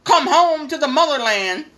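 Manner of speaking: hammed-up call to return home; mock clarion call. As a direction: proclaim it like a grand summons, half mocking. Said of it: "sent out the clarion call".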